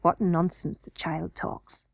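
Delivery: slow, low, soft muttering; to himself. A narrator's reading. Curdie alone doubting the child's sense, muttering to himself. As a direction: grumble it under your breath. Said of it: "said Curdie to himself".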